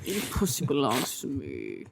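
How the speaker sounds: low gruff drill-sergeant voice; military growl. Retold or imitated. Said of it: "That's you in the military".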